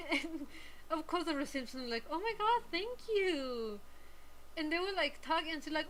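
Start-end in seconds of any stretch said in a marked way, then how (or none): 2.1-3.8 higher-pitched mock flattered receptionist; lightly put-on flustered delight. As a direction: gush a thank-you, imitating someone flattered. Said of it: "the reception lady was very flattered".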